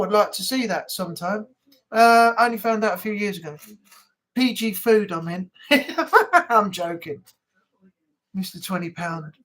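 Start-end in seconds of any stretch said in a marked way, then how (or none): 5.7-6.5 high, loud burst of laughter; real high-pitched laughing. Real, not acted. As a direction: laugh at your own joke. Laughs at own joke.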